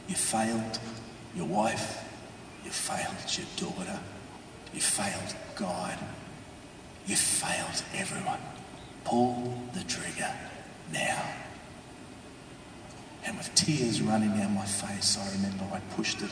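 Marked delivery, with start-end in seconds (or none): 0.1-11.2 accusing inner voice. Acted. A dark voice in his head telling him he failed, hushed accusation. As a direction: accuse softly, nearly whispered, with pauses. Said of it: "hearing this voice say to me".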